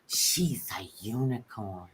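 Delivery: slow, low breathy whisper; awed whisper. Retold or imitated. Other twin in awe of the celebrity, whispering. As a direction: breathe it out softly in wonder.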